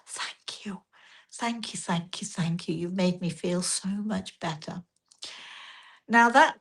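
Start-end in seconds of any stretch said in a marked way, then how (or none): 0.0-4.8 hushed, whispery gratitude, imitating tears; retold tearful thanks. Sobbing traveller thanking the woman who hugged her, imitated in a hush. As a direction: start in a whisper, softly overcome. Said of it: "she's sort of sobbing into my neck".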